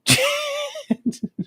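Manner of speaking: high-pitched, helpless cracking up; real high laugh. Real, not acted. Streamer reacting to viewer's Brazil joke, high laugh.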